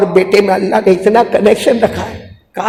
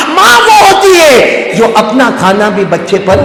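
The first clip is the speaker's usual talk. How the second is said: loud, raised preaching cry; preaching shout; 5 semitones higher, 5 dB louder than usual. Real, not acted. Preacher shouting to the congregation about mothers. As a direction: proclaim it to the crowd.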